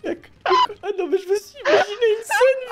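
high helpless laughter breaking the words; can't get the words out for laughing; cracking up. Real, not acted. Streamer losing it over an imagined scene, high laughing fit. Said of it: "je craque".

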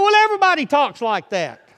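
high, loud mimicked back-talk; mock protest. Preacher imitating young people kicking back, mock protest. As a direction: push back like a defensive youngster.

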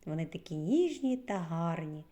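ordinary talk, nothing marked

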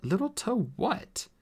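high, slow query; puzzled question. Acted. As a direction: ask it in bafflement. Artemis puzzled by the instruction.

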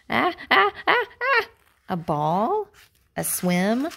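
ordinary delivery, nothing marked

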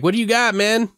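high, eager voice; eager buddy. Retold or imitated. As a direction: ask like you want a drink now. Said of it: "he's like".

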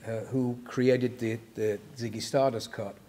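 ordinary talk

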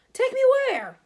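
loud, very high cry; high, panicked demand; alarmed outburst. A narrator's reading. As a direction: burst out in panic. Hagrid alarmed at being arrested.

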